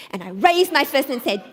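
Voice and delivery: ordinary talk, nothing marked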